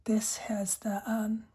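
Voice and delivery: unvoiced ASMR whisper; soft whisper. Real, not acted. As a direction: breathe the words close to the mic. Whispered ASMR pen chat.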